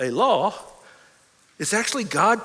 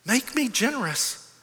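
soft, hushed closing prayer; 3 semitones lower, 6 dB quieter than usual. Acted. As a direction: pray it quietly and earnestly. Closes in prayer.

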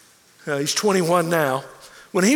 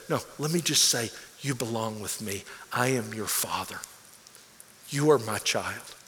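tender fatherly words; 8 semitones lower, 8 dB quieter than usual. Acted. God telling his child he belongs, soft and low. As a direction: speak it gently as a loving father.

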